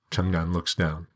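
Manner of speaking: plain reading voice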